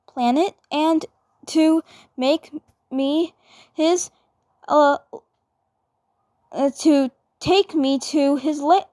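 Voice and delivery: put-on high, stammering girl voice caught out; a flustered twin sister protesting too much; flustered Mabel.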